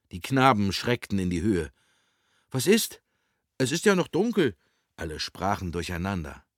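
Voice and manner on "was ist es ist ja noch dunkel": high-pitched, startled questioning; higher voice, confused and alarmed; startled boys. Acted. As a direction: protest as if just jolted awake. Boys startled awake, all talking.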